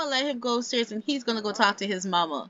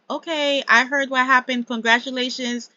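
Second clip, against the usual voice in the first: higher, louder acted congratulations; acted hands-off congratulations, pitched up; breezy acknowledgement; 5 semitones higher, 6 dB louder than usual. Acts out Anthony staying out of it.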